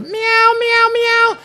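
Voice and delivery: very high-pitched imitated meows; cat meow. Preacher voicing the cat in a prison story, high meowing. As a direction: mimic a cat's repeated meow.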